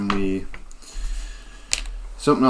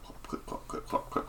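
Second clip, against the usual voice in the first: whispered clip-clop hoofbeats; hushed clip-clop of a trotting goat; clip clop sounds; 16 dB quieter than usual. Acted. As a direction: tick out the goat's hooves softly. GM voices goat hoof sounds.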